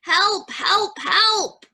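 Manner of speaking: high-pitched storybook cry for help; acted, high repeated plea. A narrator's reading. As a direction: call out as a little truck in trouble. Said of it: "cried the little blue truck".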